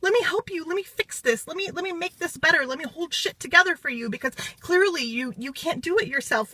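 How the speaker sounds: high, fussing, over-apologetic helper voice; mock fretful little self. To her group, mocking her small fretful self, high and fussing. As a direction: gush apologies like an anxious rescuer. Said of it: "show up in my little self and be like".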